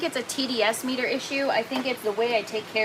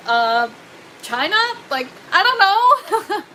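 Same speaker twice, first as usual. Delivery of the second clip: high, slow, giggling mock bafflement; laughing shrug; 11 semitones higher, 4 dB louder, slower than usual. Shrugging off viewers' question, laughing. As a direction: laugh it off, clueless. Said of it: "I'm like".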